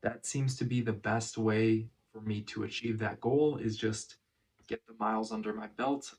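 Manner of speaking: ordinary talk, nothing marked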